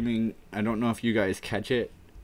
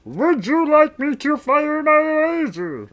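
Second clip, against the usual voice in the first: very high, loud cartoon alien; slow, booming question in a squeezed high voice; strained cartoon villain offer; Marvin the Martian; 10 dB louder, slower than usual. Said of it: "Marvin the Martian".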